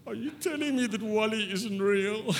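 high-pitched, hammed-up distress; mock heartbroken disbelief. Mock distress at wife's remark.

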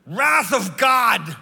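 booming drawn-out shout; mock-epic furious shout, high and loud; freaking out. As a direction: thunder it slowly like divine wrath. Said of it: "I freak out".

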